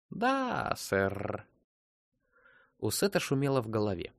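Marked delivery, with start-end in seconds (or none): 0.1-1.5 robotic computer with rolled R. A narrator's reading. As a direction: stretch it like a machine acknowledging orders. Computer replies in the story.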